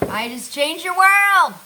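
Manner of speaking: loud, high, drawn-out boast; triumphant exclamation. Real, not acted. Cook boasting to viewers about a life-changing tip, loud and triumphant. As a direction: crow it triumphantly, slow and big.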